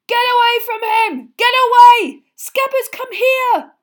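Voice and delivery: very high, very loud yells; frantic shouting. Acted. Ron screaming at Crookshanks while chasing his rat. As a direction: scream after the cat.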